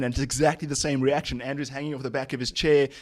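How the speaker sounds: normal talking voice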